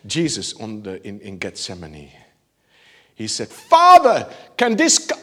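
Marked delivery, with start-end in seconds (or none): 3.5-5.2 high, loud anguished call; anguished cry. Retold or imitated. Preacher acting out Jesus in Gethsemane crying out loud. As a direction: cry out to the Father in agony. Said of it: "I think he did it like this".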